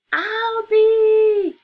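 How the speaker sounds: long, high, loud angry scream. Acted. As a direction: yell his name from upstairs in fury. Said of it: "she screamed".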